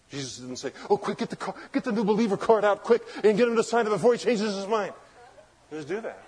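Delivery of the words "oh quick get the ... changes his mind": high, hurried, hammed-up urgency; mock panic rush. Preacher mocking hasty sign-up of a convert, frantic rush. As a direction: rattle it off like a frantic recruiter.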